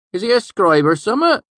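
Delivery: high, loud rustic question; curious old villager. A narrator's reading. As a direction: squint and ask, curious and loud. Cobb asks about stranger.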